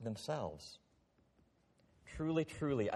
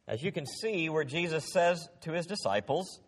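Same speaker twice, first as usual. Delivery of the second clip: ordinary talk, nothing marked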